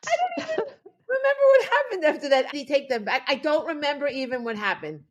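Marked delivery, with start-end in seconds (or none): none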